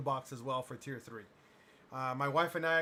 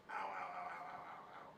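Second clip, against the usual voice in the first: whispered ows of cramp pain; breathy ow ow ow, hurting; 12 semitones lower, 15 dB quieter than usual. Real, not acted. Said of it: "My leg's cramping pretty bad".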